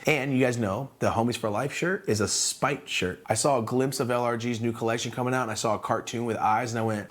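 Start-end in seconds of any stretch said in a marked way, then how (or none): none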